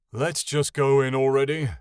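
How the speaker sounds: loud, impatient growl; loud, grumpy character voice. A narrator's reading. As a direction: growl to hurry them along. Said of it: "Ionin growled".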